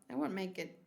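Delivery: ordinary delivery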